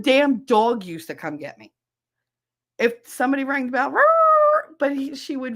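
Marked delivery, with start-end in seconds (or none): 3.9-4.8 loud, high rolling dog howl; imitated dog bark. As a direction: rrr like an alarmed dog at the doorbell. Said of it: "and bark at me".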